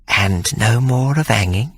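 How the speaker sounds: slow, coaxing nanny question; teasing demand. A narrator's reading. Hannah pressing Sir Jasper, slow coaxing nanny. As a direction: draw out the bargain slowly.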